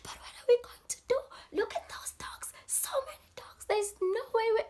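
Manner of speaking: very high, breathy, panicked whisper; terrified whisper. Retold or imitated. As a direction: whisper it in terror. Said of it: "She's like".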